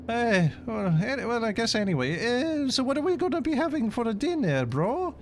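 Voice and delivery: very high squeaky mock Italian accent; high Italian bro voice. Italian bro reacts to anti-Italian talk, high mock Italian accent. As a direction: play a shrill cartoon Italian bro. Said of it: "The Italian accent".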